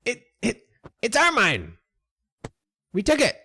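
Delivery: high rat voice; high-pitched, stubborn creature retort; defiant rat. Acted. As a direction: stand your ground as a high-voiced rat.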